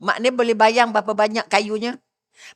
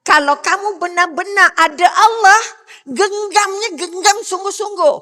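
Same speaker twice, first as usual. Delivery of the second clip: loud, higher urgent preaching; fervent exhortation; 10 semitones higher, 9 dB louder than usual. Real, not acted. Preacher urging the congregation to trust fully, fervent loud. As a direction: urge them to hold fast to God. Urging faith at full force.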